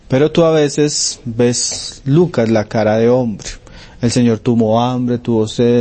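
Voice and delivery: ordinary talk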